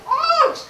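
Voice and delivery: high, sudden "ouch" yelp; acted pained yelp. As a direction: yelp as if just bitten. Bitten character cries out in pain.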